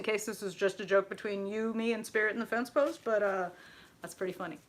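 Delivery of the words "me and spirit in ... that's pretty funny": ordinary talk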